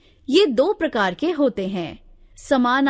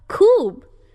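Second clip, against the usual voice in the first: delighted khoob; 8 semitones higher, 5 dB louder, much slower than usual. Shown as a demonstration. Teacher voices interjection examples.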